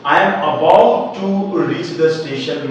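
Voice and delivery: very slow, word by word; careful teacher dictation; slow model sentence. Shown as a demonstration. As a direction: spell the sentence out slowly. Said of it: "आपको बिल्कुल समझ में आएगा".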